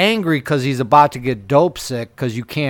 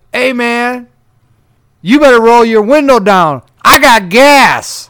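high, loud, slowed-down passenger voice; passenger's loud warning; 8 semitones higher, 7 dB louder, slower than usual. Retold or imitated. Retelling the Uber passenger's warning to the listener, loud and slow. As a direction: call it out big and slow, like a guy warning you.

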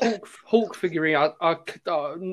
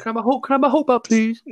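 put-on high, whiny plea, a kid begging for his toy; pleading kid; 6 semitones higher, faster than usual. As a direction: beg like a schoolboy for his toy back. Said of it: "I'm like".

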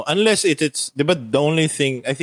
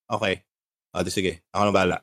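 mocking a lazy, shrugging voice, much softer and lower; flat indifferent reaction; 5 semitones lower, 4 dB quieter, faster than usual. Reviewer mocks Trese's lifeless reaction, flat and bored. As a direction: impersonate the heroine's bored, couldn't-care-less reply. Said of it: "talagang yung reaction niya".

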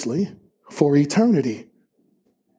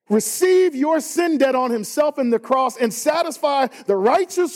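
rising preacher fervor; 8 semitones higher than usual. Real, not acted. Pastor challenging the congregation, building high and intense. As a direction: pitch climbing higher, pressing the point hard.

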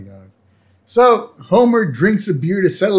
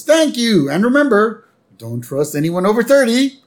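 high-pitched imitation of a concert host; acted stage introduction, pitched up; stage announcer; 10 semitones higher than usual. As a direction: introduce the next act to a crowd. Homer introduces act on stage.

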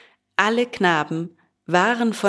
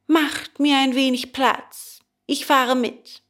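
put-on boy giving in; 6 semitones higher than usual. A narrator's reading. Defeated boy gives in.

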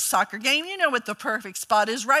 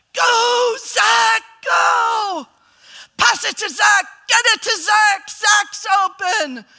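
high, loud cheering from the stands; soccer mom screaming; 11 semitones higher, 9 dB louder, slower than usual. Retold or imitated. Mom yelling to her son from the stands, shouting. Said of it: "I was that soccer mom".

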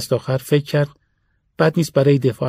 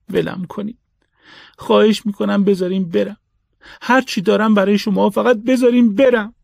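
higher, rising desperate plea; hopeless begging; 7 semitones higher than usual. A narrator's reading. Captive man pleading with his neighbors, in hopeless begging. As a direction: beg them to let you go. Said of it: "با ناامیدی التماس کرد".

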